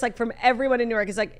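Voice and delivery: ordinary talk, nothing marked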